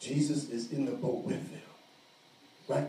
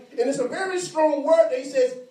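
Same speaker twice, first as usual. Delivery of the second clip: high, loud pulpit voice, pressing hard; full-force preaching; 9 semitones higher, 7 dB louder than usual. Acted. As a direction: preach it out to the whole room.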